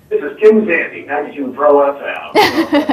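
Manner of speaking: high, projected station ID voice; radio announcer voice. Acted. Guest showing the radio spot they had him do, announcer voice. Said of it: "in that voice".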